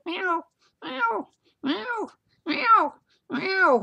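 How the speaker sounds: repeated very high-pitched meows; cat meowing. Retold or imitated. Storyteller imitating the cat back at his wife's side, high meowing. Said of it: "it started this again".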